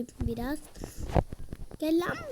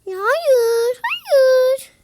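high loud cries of no; desperate no; 10 semitones higher, 8 dB louder, much slower than usual. Real, not acted. Gamer crying out as things go wrong. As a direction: plead it out. Losing in game.

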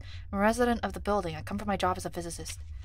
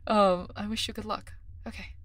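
ordinary talk

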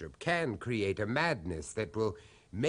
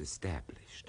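hushed whisper; 9 semitones lower, 8 dB quieter than usual. Acted. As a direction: trail off into a much softer whisper. Asking about dark origins.

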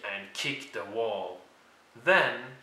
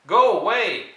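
loud dismissal; 8 semitones higher, 10 dB louder than usual. Acted. George chasing Rashida off.

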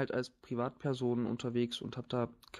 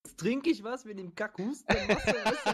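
laughing through pain; 8 semitones higher, 3 dB louder than usual. Real, not acted. Coughing from laughing.

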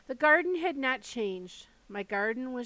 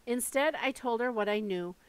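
ordinary delivery

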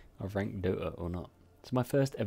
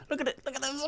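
much higher, delighted squeal; excited showing off; much faster than usual. Real, not acted. A player showing his teammates a great play, high and thrilled.

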